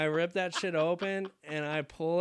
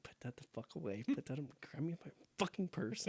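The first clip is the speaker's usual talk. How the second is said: hushed scolding; 2 semitones lower, 12 dB quieter than usual. Retold or imitated. Embarrassed mom to kid at drive-through, hushed scolding. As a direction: scold under your breath. Embarrassed mother's order, voice dropped.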